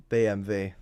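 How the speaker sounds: "B M W" as bay-em-vay, German letter names; German pronunciation. Shown as a demonstration. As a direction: demonstrate "B M W" in German, ending on "vay". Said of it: "That's how you pronounce B M W".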